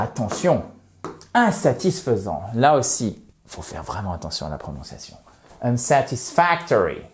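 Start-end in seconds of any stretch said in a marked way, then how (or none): 5.6-7.0 "Unsatisfactory" with main stress on FAC; light beat on SAT, strong on FAC in "Unsatisfactory"; primary and secondary stress. Shown as a demonstration. Teacher shows learners the tonic accent, careful stress. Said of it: "on repère notre accent tonique".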